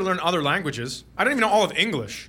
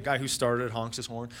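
usual speaking voice

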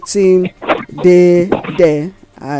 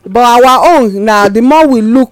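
excited boast; 5 semitones higher, 7 dB louder than usual. Real, not acted. Excited boast about the station.

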